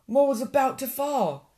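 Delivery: high-pitched suspenseful climax; alarmed narration. A narrator's reading. Narrator telling of Mole about to fall, high alarm. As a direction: raise the pitch in mounting alarm.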